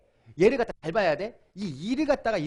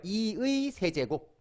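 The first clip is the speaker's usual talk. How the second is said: very slow, each syllable spaced for repeating; slow model reading; much slower than usual. Shown as a demonstration. Teacher dictating 이의 세제곱 slowly for students to repeat. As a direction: read it slowly so the class can copy. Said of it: "따라해봐".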